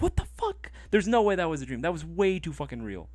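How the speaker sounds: high, startled outburst easing down; replaying the shock of the ghost rat; shaken disbelief. Retelling waking from the rat scare, startled and high.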